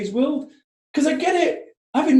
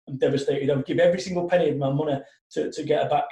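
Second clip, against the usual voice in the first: retold grieving confession; 7 semitones lower, 5 dB quieter than usual. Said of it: "came up to me afterwards crying".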